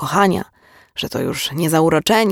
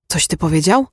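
stunned question, understated; voice not her own. A narrator's reading. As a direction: ask it in mild shocked disbelief. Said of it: "odezwała się naraz nie swoim głosem".

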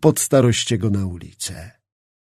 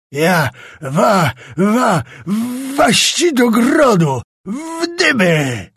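stammering, rising to a high slow scream; 7 semitones higher, 4 dB louder, slower than usual. A narrator's reading. Czapliński keeps screaming at Skrzetuski, stammering furious scream. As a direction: choke on rage then scream it out. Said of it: "wrzeszczał dalej Czapliński".

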